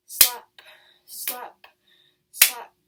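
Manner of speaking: "Slap, slap, slap" as separate, sharp beats; footstep imitation. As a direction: punch each "slap" like a bare foot landing, pausing between. Imitates bare footsteps approaching.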